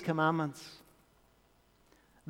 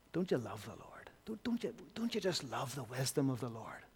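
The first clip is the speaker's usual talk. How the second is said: awed whisper, much softer; 4 semitones lower, 14 dB quieter than usual. Real, not acted. Preacher whispering in awe of the Lord's wisdom. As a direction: breathe it in wonder. Awed aside mid-quote.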